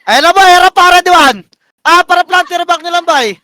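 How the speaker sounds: high, loud engage call; urgent shot-calling. Real, not acted. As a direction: shout the go signal to the team.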